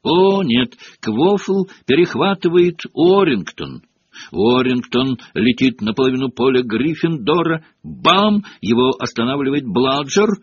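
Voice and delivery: excited play-by-play with high-pitched bursts; lively young commentator role; partisan match commentary. A narrator's reading. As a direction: call the game as a cheering fan.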